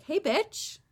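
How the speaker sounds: high, loud playful greeting; mock-brash shout of hello. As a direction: call it out high and punchy. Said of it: "to be like".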